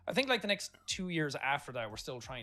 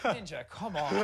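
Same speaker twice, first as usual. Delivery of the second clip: mock exasperated; 5 semitones higher, 3 dB louder, slower than usual. Mock complaint at Ninja.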